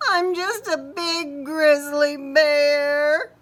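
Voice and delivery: mild hammed-up sob; tearful bear. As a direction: wail it like a sad big bear. Boris sadly protests.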